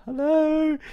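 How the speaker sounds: a sudden falsetto squeak of a hello; silly high hello. Pretend. Streamer greets his camera in a silly falsetto. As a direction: pop up into a tiny falsetto greeting.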